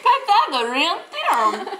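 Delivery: P=A; laughing answer. Pretend. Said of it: "The what?".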